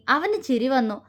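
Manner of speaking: ordinary delivery, nothing marked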